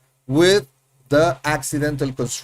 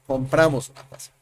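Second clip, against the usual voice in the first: quick demo; 2 semitones lower, faster than usual. Shown as a demonstration. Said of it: "You already know that".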